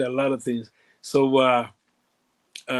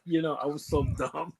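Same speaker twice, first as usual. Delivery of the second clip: ordinary delivery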